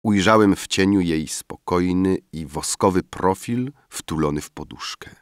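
ordinary delivery

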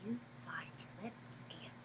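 fast breathy whisper, no voice. Acted. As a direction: whisper it like a secret. Hushing part of the song.

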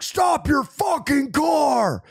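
full-blown authoritative police command shout; tactical authority voice. Retold or imitated. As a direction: bark orders like a police officer. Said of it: "tactical authority voice".

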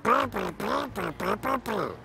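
alien language. Pretend. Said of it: "Wie spricht man das denn?".

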